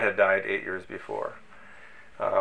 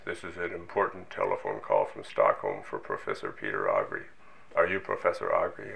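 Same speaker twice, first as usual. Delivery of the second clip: lightly voiced grave Swedish caller, low; a hint of a solemn official phone voice; serious Swedish voice; 12 semitones lower, 4 dB quieter than usual. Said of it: "very serious voice".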